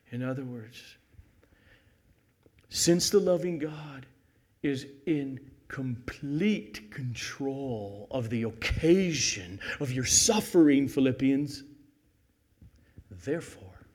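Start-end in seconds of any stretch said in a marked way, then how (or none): none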